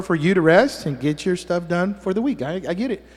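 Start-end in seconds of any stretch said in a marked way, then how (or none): none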